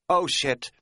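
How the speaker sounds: gasped, high alarm; gasp, then curse in dread; sudden frightened realisation; dismayed. A narrator's reading. Sees a second bear.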